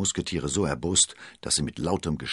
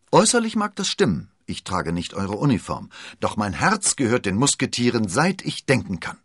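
put-on proud young man; 5 semitones higher, 5 dB louder than usual. D'Artagnan declares loyalty.

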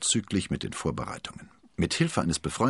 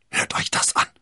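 forceful, voiceless stage whisper; hissing whisper; 4 dB louder than usual. A narrator's reading. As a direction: hiss it urgently to the friends. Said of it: "zischte er".

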